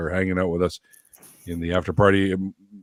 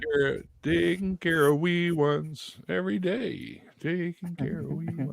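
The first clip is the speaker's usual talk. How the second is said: mock sing-song voice; 6 semitones higher, 5 dB quieter, slower than usual. Mock voice about dad duties.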